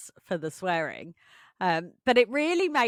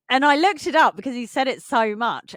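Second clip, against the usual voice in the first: usual speaking voice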